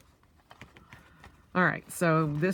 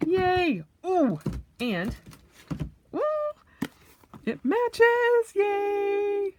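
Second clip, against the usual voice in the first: excited squeals; 3 dB louder, much slower than usual. Real, not acted. Colors match, thrilled.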